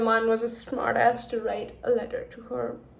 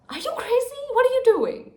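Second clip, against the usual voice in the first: much higher, loud disbelief; exasperated yell; 13 semitones higher, 3 dB louder than usual. Real, not acted. Reader yelling at Iris in disbelief.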